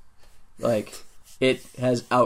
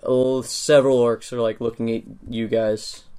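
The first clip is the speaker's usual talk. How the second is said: ordinary talk, nothing marked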